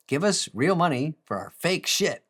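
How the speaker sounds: ordinary talk, nothing marked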